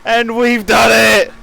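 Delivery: triumphant high shout; giddy relief. Real, not acted. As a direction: yell it out, overjoyed at finishing. Crossing the finish line.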